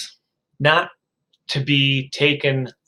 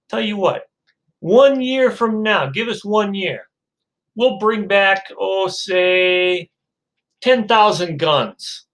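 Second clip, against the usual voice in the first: salesman pitch; 5 semitones higher, slower than usual. Acted. Seller names his offer.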